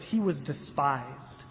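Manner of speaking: low, slow, each word weighed; solemn repetition. Shown as a demonstration. Pastor repeating the verse to the congregation, slow and low. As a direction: let the phrase sink in slowly. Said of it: "Notice that dual repetition of that phrase".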